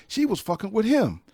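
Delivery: amazed retelling; amazed disbelief. Real, not acted. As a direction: marvel at the coincidence. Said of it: "this is how small the world is".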